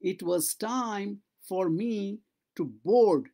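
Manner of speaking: slow, careful teacher read-aloud; slow model reading. Shown as a demonstration. Teacher reads slowly to the class, slow and careful. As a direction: spell it out slowly for students. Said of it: "teacher models slow reading".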